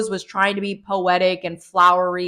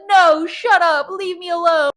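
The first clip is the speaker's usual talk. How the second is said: sulky Alice; 8 semitones higher than usual. Retold or imitated. Alice rebuffs Charlotte, high sulky pout. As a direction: brush her off, pouting. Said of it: "Alice is like".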